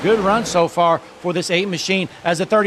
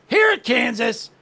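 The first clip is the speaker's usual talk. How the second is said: hyped announcer call; 6 semitones higher than usual. Acted. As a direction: call it out as the green flag drops. Race start hype call.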